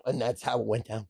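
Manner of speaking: ordinary delivery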